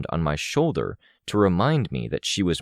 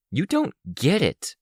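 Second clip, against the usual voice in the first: high voice close to cracking on "get"; voice weakening; 12 semitones higher than usual. A narrator's reading. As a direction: let "get" go weak, near breaking. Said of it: "My voice went weak on get".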